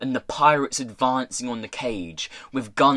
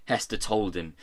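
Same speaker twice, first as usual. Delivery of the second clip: plain reading voice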